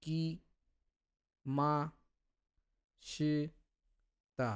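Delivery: each syllable apart with long pauses. Shown as a demonstration. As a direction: read কি মা সে তা one syllable at a time. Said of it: "তখন আপনাকে এইভাবে পড়তে হবে যে". (সিলেবল ধরে ধরে আলাদা করে পড়া)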